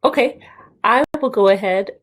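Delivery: normal talking voice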